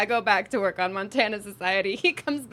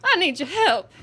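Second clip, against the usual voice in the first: calling out from across the room; 4 semitones higher, 5 dB louder than usual. Retold or imitated. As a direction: call out across the room for help. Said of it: "this man screams from the other side of the room".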